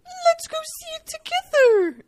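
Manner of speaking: high, slow mocking voice; mocking whiny man. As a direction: mimic a clingy guy's whine. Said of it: "All the rest have been like".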